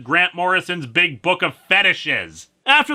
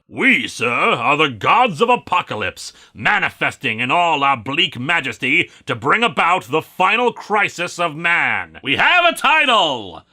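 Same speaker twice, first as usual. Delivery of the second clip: proclaiming villain voice, building at the end; grandiose evil god; 3 dB louder than usual. Acted. Evil gods announcing their bleak plan, grandiose proclamation.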